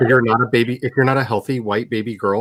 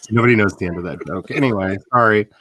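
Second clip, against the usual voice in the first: ordinary talk, nothing marked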